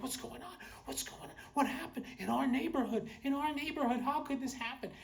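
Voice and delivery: high-pitched alarmed neighbors, mock panic; freaking-out neighbors. Neighbors finding a body in the cul-de-sac, mock freak-out. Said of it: "they were freaking out".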